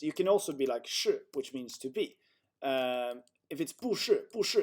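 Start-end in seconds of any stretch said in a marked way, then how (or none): 3.7-4.6 不是 with both syllables falling sharply; unnatural double fourth tone. Shown as a demonstration. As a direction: drop 不 and 是 each as fourth tones. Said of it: "it just sounds unnatural".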